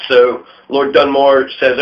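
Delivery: ordinary talk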